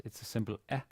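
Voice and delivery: ordinary talk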